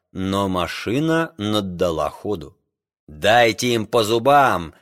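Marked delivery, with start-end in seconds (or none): none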